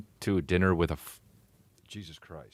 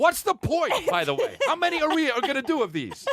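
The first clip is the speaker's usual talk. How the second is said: high laughing complaint; laughing exasperation; 3 dB louder than usual. Real, not acted.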